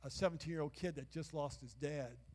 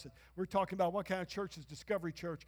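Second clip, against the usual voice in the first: ordinary talk, nothing marked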